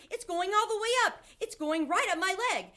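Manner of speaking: very high, loud alarmed yell; panicked yelling. A narrator's reading. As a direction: yell as it climbs your leg. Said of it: "the man was yelling".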